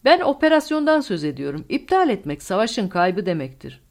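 high, loud start, then falling; agitated insistence. A narrator's reading. Colonel explaining the lost operation to Newman, agitated insistence. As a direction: press the point forcefully.

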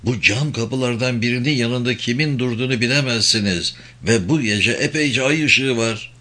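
ordinary delivery, nothing marked

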